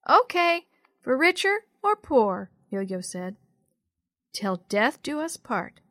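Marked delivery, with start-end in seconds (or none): 0.1-2.5 put-on high, loud little-girl voice; little sister. A narrator's reading. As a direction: chirp it back like a small child. Little sister repeats the vow.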